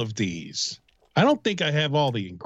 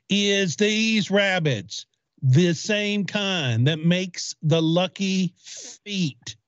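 very slow, each word drawn out; half-speed, word by word; slow, deliberate repeat; much slower than usual. Acted. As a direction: spell it out slowly for a confused listener. Repeats slowly after "Do what?.

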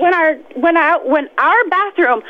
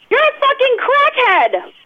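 angry; 7 semitones higher, slower than usual. Real, not acted. Furious manager insulting the prank caller.

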